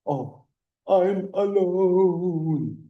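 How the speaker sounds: high, slow and forlorn; hammed-up self-pity; mock lonely sigh. Teacher acting out a lonely student, mock sad. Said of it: "cuando dices".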